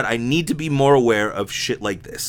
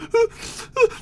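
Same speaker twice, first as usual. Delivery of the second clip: high mock laugh. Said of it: "I was like".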